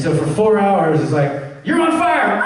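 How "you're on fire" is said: high-pitched shouted cue; mock-urgent shout, pitched way up; shouted direction. Voice director cueing a goblin, shouted direction.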